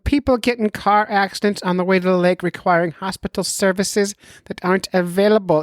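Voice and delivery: high put-on doctor voice; mock doctor voice. Doctor friend who can't stop warning the angler, mock doctor voice. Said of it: "I'll do the voice work for him".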